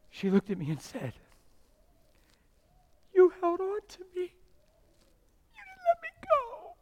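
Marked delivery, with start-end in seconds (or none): 3.1-6.8 very high, drawn-out weepy voice; high-pitched emotional falsetto; imitated tearful wife. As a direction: act out a wife overcome, in a slow high wail. Retells wife's tearful words to him.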